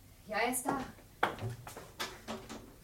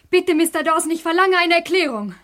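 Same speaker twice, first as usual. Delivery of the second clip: higher, louder, insisting; indignant demand; 5 semitones higher, 8 dB louder than usual. Acted. Wife demands an explanation from the detective, loud and indignant. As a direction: demand an answer, outraged.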